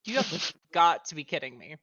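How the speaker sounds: loud disbelief at a bad roll; exasperated. Real, not acted. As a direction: groan it out in frustration. Player reacts to a bad roll.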